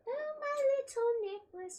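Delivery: high, sing-song delight; cutesy squeal. Acted. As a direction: coo over the little necklace. Squeals over her necklace.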